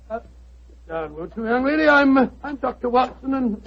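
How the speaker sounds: normal talking voice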